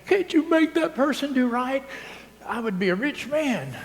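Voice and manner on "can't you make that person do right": whiny parishioner. Retold or imitated. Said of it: "a parishioner told me".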